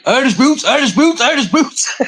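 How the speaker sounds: high mocking voice, repeated chant; mocking impression. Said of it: "You hear him".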